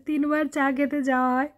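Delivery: ordinary delivery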